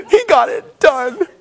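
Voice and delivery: slow, high, sobbing and laughing at once; teary laugh. Retold or imitated. Preacher imitating himself crying at a movie, slow sobbing laugh. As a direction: drag it out as tears and laughter mix. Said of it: "I cry in every movie".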